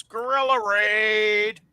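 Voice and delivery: drawn-out high holler; big, playful announcer-like call; hammed-up raid call. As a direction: stretch it out like a rallying cry. Calling out a chat member's raid greeting.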